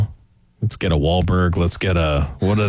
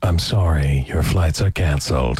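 airport announcement; 10 semitones lower, slower than usual. Pretend.

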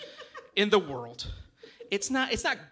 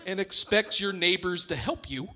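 normal talking voice

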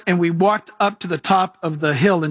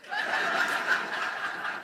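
airy high laugh; high unvoiced laugh; 11 dB quieter than usual. Real, not acted. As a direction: break into a breathless laugh. Laughs at own joke.

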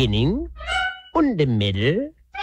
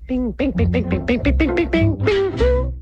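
very high plucked bings climbing to a squeak; ping out a tiny fiddle theme, rising; little fiddle imitation; 12 semitones higher than usual. Storyteller plays the fiddle's theme, high pinging voice. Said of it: "the theme of the little fiddle".

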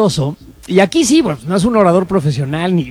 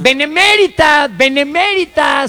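"benemérita" stretched slow and much higher; mocking slow emphasis; 7 semitones higher, slower than usual. Shown as a demonstration. Host mocking the diputado's misreading, slow and high. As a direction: spell out "benemérita" slowly, high and incredulous. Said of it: "de la benemérita".

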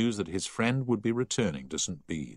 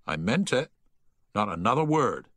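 higher, mildly stern reprimand; stern warning; 4 semitones higher, 3 dB louder than usual. A narrator's reading. Prefect catching whisperers after lights out, sternly. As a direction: warn them firmly that it is final.

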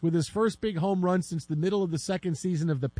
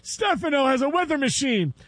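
high, loud mock parent on the phone; hammed-up soap fan's urgent outburst; soap-obsessed parent; 9 semitones higher, 4 dB louder than usual. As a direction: exclaim it high, imitating a TV-hooked parent. Voicing a parent absorbed in soap.

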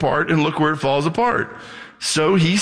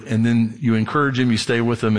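ordinary talk

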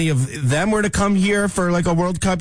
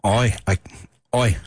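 tentative British-accented interjection; British bloke's oi; 6 semitones lower than usual. Shown as a demonstration. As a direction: try out a British "oi". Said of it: "You have to be a British man".

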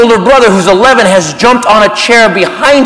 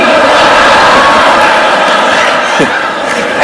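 Vader helmet breath. Retold or imitated. Vader breathing after greeting.

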